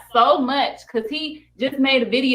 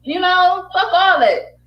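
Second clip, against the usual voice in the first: raised, slow and defiant; higher, slow fed-up kiss-off; defiant dismissal; 9 semitones higher, 5 dB louder, slower than usual. Real, not acted. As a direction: brush them all off, louder. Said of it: "got me feeling like ice in my veins".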